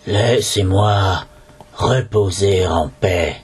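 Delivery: low, slow mummy murmur; murmur from beyond the grave. A narrator's reading. Mummy pleads to be left in peace, low and slow. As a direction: drag the words out low like a corpse speaking. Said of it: "suivie d'un murmure d'outre-tombe".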